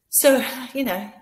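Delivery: ordinary delivery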